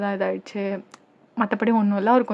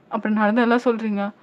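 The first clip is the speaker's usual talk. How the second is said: plain reading voice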